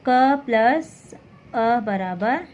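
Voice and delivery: क plus drawn out very slowly; slow explanation. Shown as a demonstration. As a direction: spell out the sum, word by word. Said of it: "देखिए किस प्रकार से".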